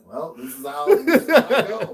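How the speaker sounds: high, loud voice breaking into laughter; laughing resignation. Retold or imitated. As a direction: laugh through a retold moment of doom. Laughingly retells own near-drowning thought.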